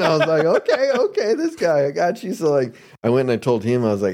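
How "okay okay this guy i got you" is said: okay okay pitched up high, then settling down; gleeful, like he's found his mark; sly excitement. Retold or imitated. Newcomer spotting a sponsor to manipulate, eager and sly. Retold inner thought, spotting a target.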